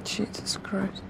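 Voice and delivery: slow hushed whisper of dread. Real, not acted. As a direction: breathe it out under your breath. Tense moment, hushed reaction.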